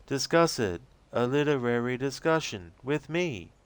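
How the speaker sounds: high, slow, pleading voice; guilty and bewildered, near tears; distraught Rose. Acted. Rose confessing her betrayal to the agent, high and distraught.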